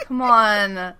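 drawn-out, hammed-up begging; mock pleading. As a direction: plead playfully, stretching each word out. Acting out Siler begging for affection.

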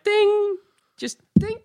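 high ringing "ding"; imitated ding. Bird catcher demonstrates the sighting, high-pitched ding. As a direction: chime a very high, held ding. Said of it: "it was like".

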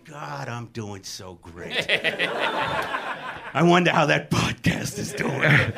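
low, soft, self-satisfied tipsy murmur; mock martini-mellow musing; tipsy Craig Robinson. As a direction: play a contented drinker musing to himself, way down low. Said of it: "he's on his fourth martini going".

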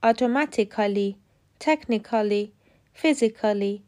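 Automatically, technically, physically each slowly ending in "-kali"; mispronounced endings. Shown as a demonstration. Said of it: "اشتباه تلفظ شدن". (تلفظ اشتباه Automatically، technically، physically با «ایکالی»)

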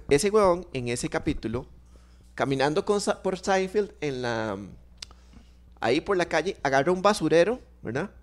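normal talking voice

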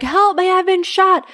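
high-pitched imitated plea, only talking volume; mock screaming. Retelling the man on the ground calling out, mock screaming. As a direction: cry out like the wounded man, held back.

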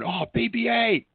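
put-on high-pitched child's exclamation; imitated excited kids. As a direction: squeal it like a kid spotting a favourite character. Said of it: "there was these kids".

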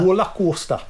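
put-on Geordie vowels; attempted Geordie accent. Shown as a demonstration. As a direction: echo the target word in Geordie. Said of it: "if you wanna do Geordie".

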